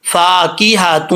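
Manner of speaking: slow tajweed reading of فَاكِهَةٌ; teacher demo of فَاكِهَةٌ; Quranic recitation. Shown as a demonstration. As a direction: recite فَاكِهَةٌ slowly with full Arabic sounds. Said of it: "تو کیسے پڑھیں گے؟".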